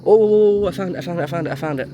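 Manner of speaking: real rush of excitement; loud "oh" tumbling into eager repeats; excited discovery. Real, not acted. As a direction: blurt it out, high and loud at first. Spots what was searched for.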